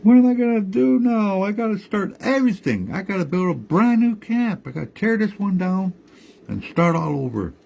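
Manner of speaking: put-on high, distressed old woman scolding; grandma wailing at the tree over her ruined camp; upset grandma yelling. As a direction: cry out at the tree who broke his promise. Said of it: "she yelled at that tree".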